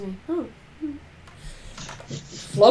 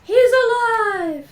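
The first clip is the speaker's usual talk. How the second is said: high, slow proclamation; drawn-out high cry; triumphant cry; 11 semitones higher, slower than usual. Acted. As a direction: stretch it out high, in mock triumph. Echoes the cry, mad scientist style.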